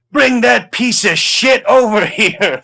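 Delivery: hammed-up order to haul him over; imitated shout. Sergeant ordering the badly dressed actor brought over, mock shout. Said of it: "And then he shouts".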